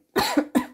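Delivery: harsh dry cough; single sharp cough. Real, not acted. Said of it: "je tousse encore".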